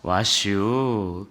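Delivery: very slow drawn-out Chungcheong greeting; 충청도 사투리로 아주 느리게 늘어뜨린 "와슈"; Chungcheong barber. Retold or imitated. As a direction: stretch it long like a country barber. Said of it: "아저씨가 뭐라 그랬을까요".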